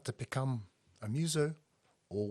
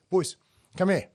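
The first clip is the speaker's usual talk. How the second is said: loud call across the room; 6 semitones higher, 8 dB louder than usual. Retold or imitated. Reader calling his band over, loud call. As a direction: call the boys over, raised voice.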